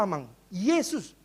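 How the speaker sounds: Yesus said slowly with a clear y; slow correction. Shown as a demonstration. Pastor corrects the elder, saying Yesus slowly. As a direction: draw out Yesus patiently. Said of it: "Bukan, salah".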